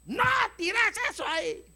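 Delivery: grito agudo de padre que perdió la paciencia. Acted. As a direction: grita la orden, muy aguda, actuando el hartazgo. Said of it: "Cuando mi esposa me oye gritar". (grito paterno exasperado)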